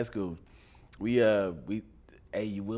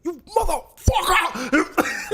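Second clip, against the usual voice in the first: high, drawn-out mock scream; exploding father; 13 semitones higher, much slower than usual. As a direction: imitate a dad suddenly losing it. Retelling father exploding at son.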